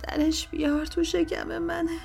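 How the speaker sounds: high, weak captive voice; forced, pained speech. A narrator's reading. Captive begs torturer to pull it out, strained plea. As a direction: force words out slowly between pains.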